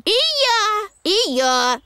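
eager shout. Acted. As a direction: call it out to join the race.

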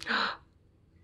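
quiet breathy gasp; a sharp little intake of breath; soft gasp. Acted. Story gasp.

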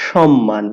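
সম্মান repeated with a held double m; correct again. Shown as a demonstration. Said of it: "আমি আবার বলছি". (সম্মান আবার, দ্বিত্ব ম দিয়ে)

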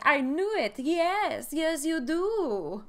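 loud, high cartoon Russian accent, gloating; bad Boris and Natasha accent. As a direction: crow it in a hammy Russian accent. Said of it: "like, Boris and Natasha accent".

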